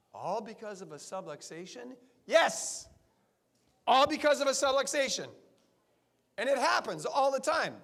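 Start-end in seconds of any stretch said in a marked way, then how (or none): 2.3-7.8 loud, high, emphatic yes; emphatic shout. Real, not acted. Chiropractor answering the crowd's doubt, loud emphatic. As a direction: punch it out with full conviction.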